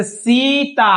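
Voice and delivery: सीता with the ई held long and slow; long ee in Sita. Shown as a demonstration. Teacher shows students सीता with a drawn-out long ई. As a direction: stretch the सी in सीता, draw it out slowly. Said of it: "ज्यादा इस पे timing दे रहे हैं".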